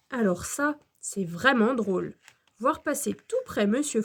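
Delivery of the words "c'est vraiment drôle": usual speaking voice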